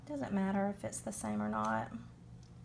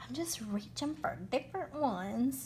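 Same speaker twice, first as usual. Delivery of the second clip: slipping into a Southern accent; 3 semitones higher than usual. Real, not acted. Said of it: "excuse my southern accent coming out there".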